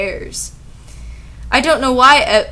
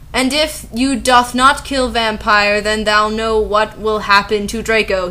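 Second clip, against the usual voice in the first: ordinary delivery, nothing marked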